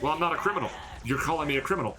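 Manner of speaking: a lightly voiced touchy customer; offended customer. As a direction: protest like someone insulted. Customer's indignant protest, acted out.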